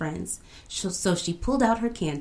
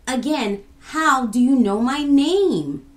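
raised, louder, baffled demand; insistent question; 6 semitones higher, 6 dB louder than usual. A narrator's reading. Kofi asking the girl a second time, insistent question. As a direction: press her for an answer.

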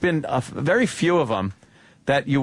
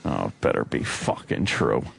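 much lower, put-on growl of a voice; deep mock voice; 4 dB quieter than usual. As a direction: drop the voice way down for a joke. Same line said again in deep voice.

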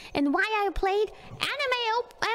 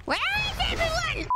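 high plaintive wail; 10 semitones higher than usual. Real, not acted. Player calling for everyone to come, high plaintive wail. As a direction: wail for the missing party. Calls for missing teammates.

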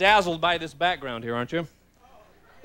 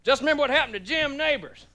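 higher, fatherly warning voice; mock his father's warning, pitched up; imitating dad; 7 semitones higher than usual. Father warning his son about moving out, mock fatherly. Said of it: "My father kept going".

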